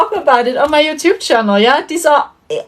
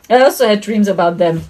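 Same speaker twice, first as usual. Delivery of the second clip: ordinary talk, nothing marked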